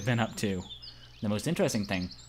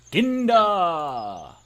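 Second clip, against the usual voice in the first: louder, higher proclamation of "Ginda"; proclaimed; 6 semitones higher, 7 dB louder than usual. Acted. Reading the monument name, proclaimed louder. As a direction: announce "Ginda" like a grand inscription.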